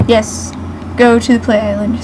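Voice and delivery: ordinary delivery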